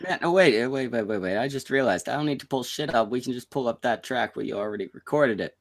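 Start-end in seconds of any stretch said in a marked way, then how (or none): none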